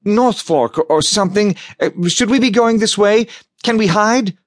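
high, hurried worried questions; fretting under fire; anxious urgency. Acted. Nina panicking during chase.

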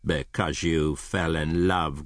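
slow, disdainful echo; growled. Acted. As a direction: repeat it slowly with a growling contempt. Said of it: "growled Scrooge".

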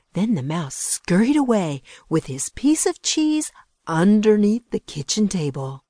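plain reading voice

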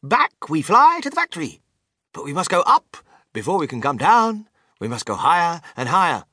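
high launch, easing a little; excited showman inventor. A narrator's reading. Mister Wonka rallying his passengers to go up, excited. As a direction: announce it with grand zeal.

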